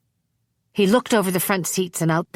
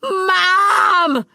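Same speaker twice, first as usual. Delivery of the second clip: put-on much higher, much louder, a desperate boy's scream; screaming for mom; 6 dB louder than usual. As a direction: scream for your mother with all you have. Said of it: "screamed Wayne".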